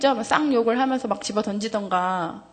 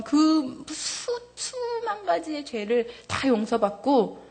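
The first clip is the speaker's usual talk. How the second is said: crying; 5 semitones higher, much slower than usual. Real, not acted. Testimony of being forgiven, voice breaks.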